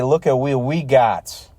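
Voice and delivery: sarcastic show-off voice; hammed-up local pride; mock boastful Pittsburgher. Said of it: "That's when they say".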